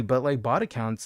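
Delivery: ordinary talk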